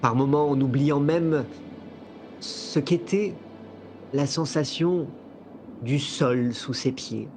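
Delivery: calm, measured storytelling voice; game master narration. A narrator's reading. Game master sets the scene.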